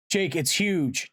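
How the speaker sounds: high, slow, alarmed call; yelled down. A narrator's reading. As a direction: call it down from far above. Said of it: "Tobias yelled down from above".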